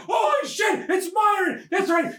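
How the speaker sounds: high-pitched excited exclaiming; mock-shocked reveal. Comic retelling who the Dementor is, mock-astonished. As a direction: hype up the villain's unmasking.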